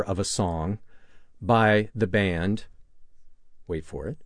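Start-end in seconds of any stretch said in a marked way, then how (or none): 3.7-4.2 low, hushed teaser before the reveal; suspenseful aside. Real, not acted. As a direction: drop right down to build suspense. Teasing pause before the reveal.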